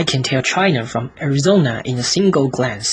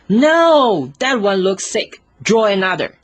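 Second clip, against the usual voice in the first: put-on little boy objecting; 6 semitones higher than usual. Little prince judging the sheep, high childlike voice. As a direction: dismiss the drawing like a picky child. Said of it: "He looked at it carefully and said".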